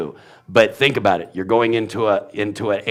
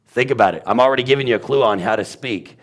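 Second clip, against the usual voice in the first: ordinary talk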